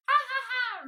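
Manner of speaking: loud, high acted dragon guffaw; roaring laugh. A narrator's reading. Tickled dragon helpless on the ground, roaring with laughter. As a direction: roar out a big staged laugh. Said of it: "roared the dragon".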